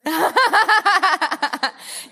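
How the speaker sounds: a big high laugh; loud laugh. Real, not acted. Laughs at student's seven-minute joke.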